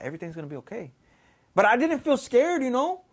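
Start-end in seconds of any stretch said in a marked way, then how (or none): 0.0-0.9 very soft, low reassurance; retold soothing. Spirit massaging his hurt leg, retold soft reassurance. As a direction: hush it gently, imitating a comforter.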